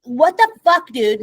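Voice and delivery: normal talking voice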